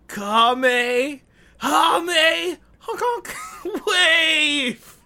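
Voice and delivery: rising shouted goofy attack name; silly clown attack shout. Pretend. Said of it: "let me try".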